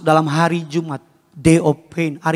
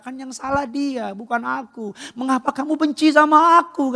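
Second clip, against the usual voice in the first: God pleading; 8 semitones higher than usual. Acted. Acts God pleading with deserters.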